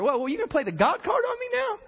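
high-pitched incredulous retort; mock indignant disbelief. Joseph answering brothers who play the God card, indignant. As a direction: challenge them in rising disbelief.

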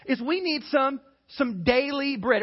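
ordinary talk, nothing marked

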